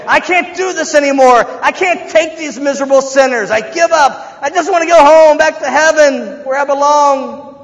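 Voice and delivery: loud, high exasperated outburst; imagined giving up. Retold or imitated. Savior imagined quitting on sinners, loud high outburst. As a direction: shout it like you've had enough of everyone. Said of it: "throw his hands up in the air and say".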